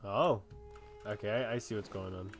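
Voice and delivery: normal talking voice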